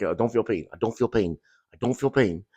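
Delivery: soft, low self-talk, repeated evenly; checking himself under his breath; muted self-check. Retold or imitated. Retells his body check under fire.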